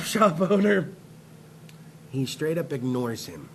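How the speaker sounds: ordinary talk, nothing marked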